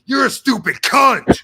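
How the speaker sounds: loud, high, aggressive outburst; hostile shouted insult. Shown as a demonstration. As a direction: yell it as a vicious insult. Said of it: "But not like".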